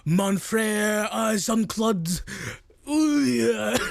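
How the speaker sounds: lightly put-on French accent; a playful hint of French. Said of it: "speak in a French accent".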